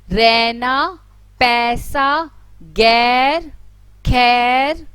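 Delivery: रैना, पैसा, गैर, खैर with a loud, lengthened ऐ; long ai vowel demo. Shown as a demonstration. Teacher to pupils, lengthening the ऐ loudly. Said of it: "थोड़ा सा उच्चारण दीर्घ हो जाता है".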